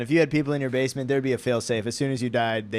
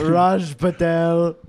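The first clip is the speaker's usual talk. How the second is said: slow, louder put-on accent; King Julian accent; 2 semitones higher, 5 dB louder, much slower than usual. Customer giving a full name, in a drawn-out put-on accent. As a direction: stretch the name in a mock Indian-style accent. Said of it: "This is King Julian".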